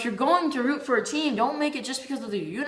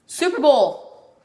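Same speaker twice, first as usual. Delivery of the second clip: excited announcement; 4 semitones higher, 8 dB louder, slower than usual. Acted. Opening shout of vlog topic.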